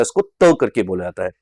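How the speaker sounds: त said as a soft dental t, not थ. Shown as a demonstration. Teacher to students: त as a soft dental t. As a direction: make the त dental and unaspirated. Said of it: "English में थ नहीं बोला जाता".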